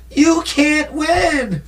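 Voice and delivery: high, slow, frightened plea; imitating Adrian begging Rocky not to fight; scared Adrian. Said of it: "She literally told Rocky".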